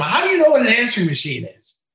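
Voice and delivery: high, loud yell back at a heckler; retold yell. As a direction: holler it back, re-enacting the comeback. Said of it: "I even yelled to him".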